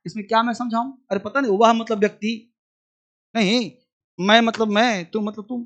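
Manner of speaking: usual speaking voice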